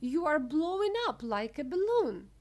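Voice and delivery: high, loud horrified cry; mother screeching in alarm. A narrator's reading. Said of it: "screeched Missus Beauregard".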